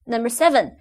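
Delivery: normal talking voice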